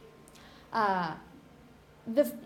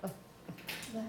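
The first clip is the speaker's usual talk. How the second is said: tired schwa; 14 dB quieter than usual. Shown as a demonstration. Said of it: "you're a bit tired".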